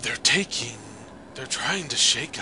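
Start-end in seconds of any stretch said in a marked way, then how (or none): none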